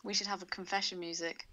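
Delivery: ordinary talk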